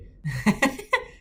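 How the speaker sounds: sudden giggle burst; high laugh; high burst of laughter. Real, not acted. Laughing at Scots being silly.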